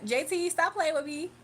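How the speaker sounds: usual speaking voice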